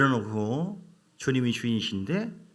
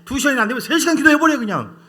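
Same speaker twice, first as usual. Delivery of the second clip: fast, higher and louder preaching shout; fervent shout; 10 semitones higher, 10 dB louder than usual. Real, not acted. Preacher shouting at the congregation to pray, fast and fervent. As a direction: blast the call to pray.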